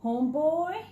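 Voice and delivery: high, laid-back voice for the cool cat; cool cat Chato. Acted. Cat talking to himself, cool and easy. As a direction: play it smooth and pleased with himself. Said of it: "Chato said to himself".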